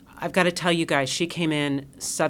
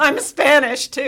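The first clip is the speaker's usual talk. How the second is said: high, loud, bright mock declaration; playful mock announcement; 9 semitones higher, 8 dB louder, slower than usual. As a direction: announce it like a proud surprise reveal. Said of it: "if you go".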